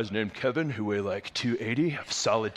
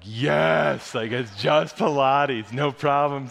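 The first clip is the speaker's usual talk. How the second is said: cocky dismissal; 5 dB louder, much slower than usual. Retold or imitated. Cocky recruits dismissing Pilates.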